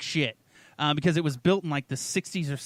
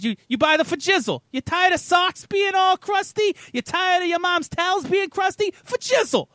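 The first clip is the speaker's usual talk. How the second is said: high, loud, hyped-up infomercial pitchman; Vince infomercial pitch; 12 semitones higher, 3 dB louder than usual. Retold or imitated. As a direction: sell it like a late-night TV huckster. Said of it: "Vince sold them, the, the infomercial guy".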